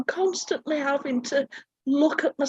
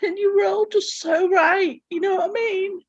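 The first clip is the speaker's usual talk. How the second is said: higher, weepy, breaking voice; crying through the words; 4 semitones higher than usual. Real, not acted. Thanking the group through tears, higher and weepy. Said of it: "I'm sat here in bits".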